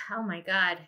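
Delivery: ordinary delivery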